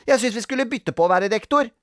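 put-on high, loud child's suggestion; insistent little-girl voice; arguing child. A narrator's reading. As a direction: press for a fair turn. Sara argues for turns.

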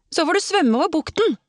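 high, sharp retort called out; shouting back. A narrator's reading. Emma to Tore in the sea, shouting back. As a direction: call it back at a high pitch. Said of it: "ropte hun tilbake".

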